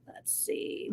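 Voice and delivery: barely audible whisper; absent, whispered muttering; whispered to self. Real, not acted. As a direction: think aloud under your breath. Murmuring to self while checking schedule.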